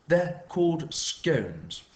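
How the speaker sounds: slow, pointed "scones"; correcting pronunciation. A narrator's reading. Neighbour corrects how the mum says scones, slowly. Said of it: "Patricia corrected".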